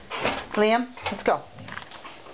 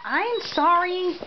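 much higher, slow sorry to the dog; high mock apology; 12 semitones higher, much slower than usual. Real, not acted. Owner apologizing to a puppy she nearly stepped on, high and slow. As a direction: coo a sorry to the pup underfoot. Dog under her feet.

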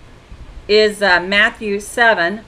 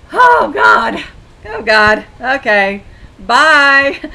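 loud, laughing exasperation; exasperated exclaim; 2 semitones higher, 7 dB louder, much slower than usual. Retold or imitated. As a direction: throw it out loud and cut him off. Brushing off the Jehovah's Witness.

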